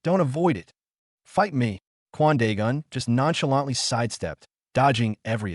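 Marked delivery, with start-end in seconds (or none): 0.0-1.8 understated yell at an opponent; lightly acted scream, pitch lifted; mild narrated scream. A narrator's reading.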